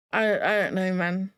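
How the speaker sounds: ordinary talk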